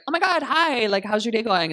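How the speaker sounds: high, loud excited greeting; gushing fan. Retold or imitated. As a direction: gush at your idol. Gushing at Crystal Method.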